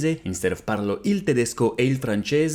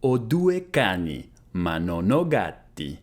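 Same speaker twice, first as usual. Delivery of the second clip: slow, careful, clearly spaced words; slow model repeat; 4 semitones lower, slower than usual. Shown as a demonstration. Teacher repeating Italian for students, slow and careful. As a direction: say it slowly for learners to copy. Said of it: "slow repeat of model".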